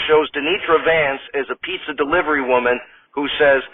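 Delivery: ordinary talk, nothing marked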